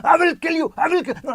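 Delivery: sudden rage. Pretend. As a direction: flip into a shouted threat. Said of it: "I can demonstrate you".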